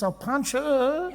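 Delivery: put-on high, slow childish grumble; jealous sibling. Jealous child reacting to praise of sister, high and drawn-out.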